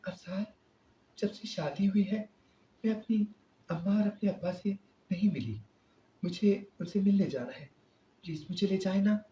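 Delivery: high, soft hesitant plea; wife timidly begging husband to visit parents; timid young wife. A narrator's reading. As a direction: gather courage and ask him softly. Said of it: "اس نے ہمت کر کے کہا".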